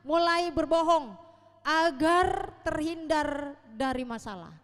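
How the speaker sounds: loud, driving pulpit delivery; preaching at full force. Acted. Preacher lists childish traits to the congregation, at full force. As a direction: preach it out to the whole hall.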